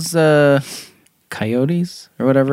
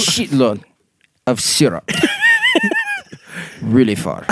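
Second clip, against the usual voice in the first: comic Russian convict; deadpan mock-Russian; Russian accent; 7 semitones higher than usual. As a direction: confess in a put-on Russian accent, phrase by phrase. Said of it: "I don't know why he's Russian".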